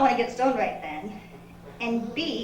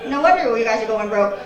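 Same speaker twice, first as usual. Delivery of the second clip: normal talking voice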